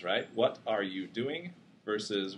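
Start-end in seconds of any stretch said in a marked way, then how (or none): 0.3-1.5 slow, separated words; carefully enunciated. Shown as a demonstration. Lecturer contrasting two understandable utterances, clearly enunciated. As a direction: pronounce each word fully and apart. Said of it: "enunciating nicely".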